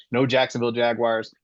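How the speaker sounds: normal talking voice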